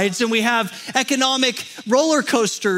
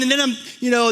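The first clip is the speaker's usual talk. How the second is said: ordinary delivery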